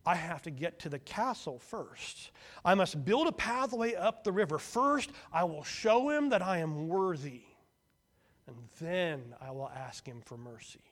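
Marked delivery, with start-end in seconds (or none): 0.0-7.5 earnest insistence. A narrator's reading. Striving brother insists.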